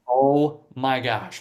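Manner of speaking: slow, low, stretched-out retold exclamation; drawn-out dismay. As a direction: stretch each word out in weary disbelief. Retold dismay at losing money.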